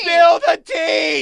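very high, drawn-out mock scream; mock yelling. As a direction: yell each word slowly as if that explains it. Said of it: "yelling it louder is gonna make you understand".

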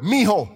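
"mijo" run together as one Spanish word, MEE-ho; "mijo" as one word with a throaty h; Spanish contraction. Shown as a demonstration. Preacher teaching Spanish, saying "mijo" as one word. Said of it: "they make it one word".

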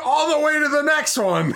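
high, loud, laughing; laughing mock answer. Cohost imitating the host's answer to a therapist, laughing. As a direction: shout it through laughter.

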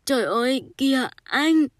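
high, loud cry of distress; startled, choked exclamation, pitched high; choked outcry. A narrator's reading. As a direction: cry out as if choking up with shock. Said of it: "Bà ta vùng dậy nghẹn ngào".

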